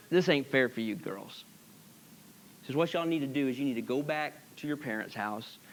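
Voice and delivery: put-on a mother letting her girls go, quiet and tender. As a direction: softly send them home for their own good. Naomi gently sending the girls home.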